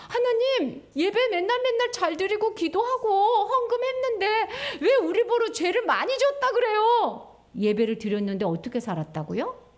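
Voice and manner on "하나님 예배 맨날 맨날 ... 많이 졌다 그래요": higher, aggrieved complaining; whiny protest. Acted. People protesting to God, lightly whiny. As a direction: whine that it isn't fair.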